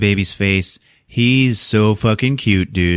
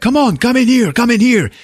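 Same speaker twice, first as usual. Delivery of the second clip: high, louder hurried beckoning; urgent urging; 14 semitones higher, 5 dB louder than usual. Retold or imitated. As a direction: rush her in through the door. Javier urging her inside.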